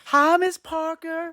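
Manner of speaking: high, slow, loud put-on voice; squeaky drawn-out greeting; movie character quote; Tony Cox impression. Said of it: "scene in Friday".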